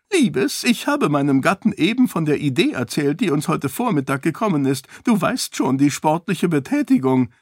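high, bright eager chatter; a fussy queen gushing to her husband. A narrator's reading. Queen gushing to the king about sport, high and eager.

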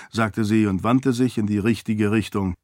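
usual speaking voice

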